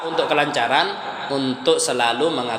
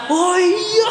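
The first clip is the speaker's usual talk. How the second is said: very high, loud forced laughing; fake laugh; 5 dB louder than usual. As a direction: pretend to find it hilarious. (tertawa dibuat-buat dengan suara melengking)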